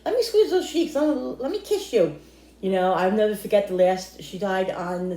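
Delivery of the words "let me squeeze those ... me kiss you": high, cooing grandma with a laugh; doting grandma. Retold or imitated. Grandma cooing at her grandkids, high and laughing. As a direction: coo it like a grandma pinching cheeks. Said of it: "she would squeeze their cheeks".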